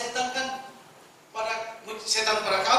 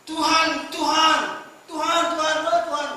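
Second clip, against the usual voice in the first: loud, higher cry of Tuhan Tuhan; loud mock pleading of the crowd; 5 semitones higher, 6 dB louder than usual. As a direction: beg like desperate followers. Pleading crowd crying Lord Lord.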